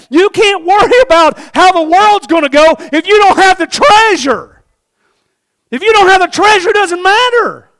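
high, loud, impassioned cry; full-force preaching. Real, not acted. Preacher to congregation about the treasure, impassioned shouting. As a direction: drive each phrase home at a shout.

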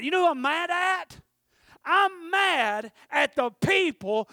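loud, high, impassioned preaching cry; preaching at full force. Acted. Preacher telling the church how mad he got, loud and high. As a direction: shout it out, stretching the angry lines.

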